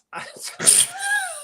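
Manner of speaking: squealing laugh, breathless; high-pitched laugh. Real, not acted. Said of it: "now you're going blue".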